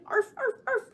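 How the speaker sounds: high yappy barks; dog barking. Pretend. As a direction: bark like a little puppy. Dogs barking in a rhyme.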